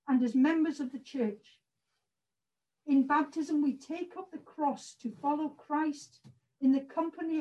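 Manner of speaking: solemn formal service reading; formal liturgy. Acted. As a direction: read the liturgy with measured dignity. Reads baptism liturgy.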